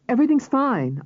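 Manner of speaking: horrified. A narrator's reading. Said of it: "I said, horrified".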